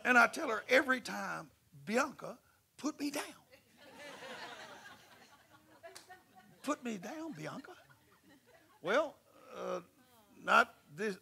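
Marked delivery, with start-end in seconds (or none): 1.8-7.7 high, soft laughing plea; giggling put me down; mock pleading. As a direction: beg half-jokingly to be set down. Begging friend to put him down.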